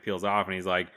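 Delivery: ordinary delivery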